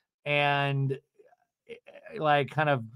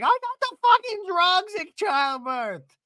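high, slow, stretched-out protest; hammed-up panicked comeback; mock hysterical wail; 4 dB louder than usual. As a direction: imitate a reality star wailing back. Acting Gina's panicked comeback.

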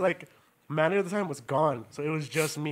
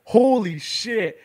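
drawn-out, louder stunned exclamation; slow, raised astonished reaction; 4 semitones higher, 5 dB louder, much slower than usual. Retold or imitated. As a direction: stretch it out in shock, pitch up. Retelling stunned reaction to a slap.

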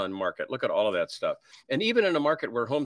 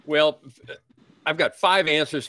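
loud comic well; 6 semitones higher, 7 dB louder, slower than usual. Real, not acted. Guest's loud ironic reply.

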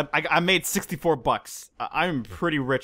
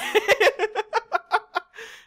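high laugh. Real, not acted. Laughing at ripped pants.

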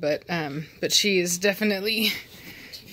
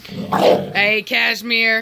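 louder firm call; stern call; 3 semitones higher, 8 dB louder, much slower than usual. Real, not acted. Owner calling Cashmere off the other dog, louder stern. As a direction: call her off sharply.

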